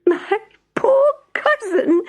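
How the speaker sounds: high, slow, laughing mouse voice; squeaky laughter. Acted. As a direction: laugh at your poor cousin. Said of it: "She squeaked with laughter".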